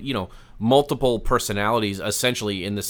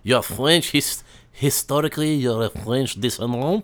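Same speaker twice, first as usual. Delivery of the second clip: hammed-up French accent; mock Frenchman, pleased to find a countryman; put-on French voice. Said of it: "best French voice on for this".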